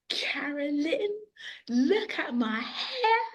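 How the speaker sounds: put-on high, drawn-out singsong child's call; delighted child. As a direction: imitate a child proudly showing off, stretching the words. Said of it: "the child came in".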